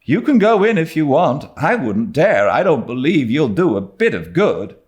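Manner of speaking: put-on high, breathless young girl telling news; a giddy girl half thrilled, half scared; Diana, excited girl. A narrator's reading. Diana to Anne, breathless and high.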